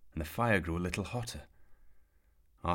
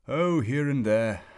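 put-on slow, higher, louder playful old man; 7 semitones higher, 9 dB louder, slower than usual. A narrator's reading. Mysterious old man answering Arthur, slow and playful. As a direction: tease it out like a wizard.

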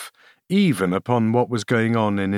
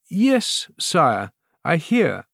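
halting, frightened queen; stammering queen; 7 semitones higher, much slower than usual. A narrator's reading. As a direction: stumble over the answer in fear. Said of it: "stammered the queen".

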